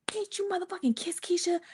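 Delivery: high, hushed confrontation; retold menacing whisper. Girlfriend confronts Kay in the bathroom, fierce whisper. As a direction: whisper the accusation, imitating her.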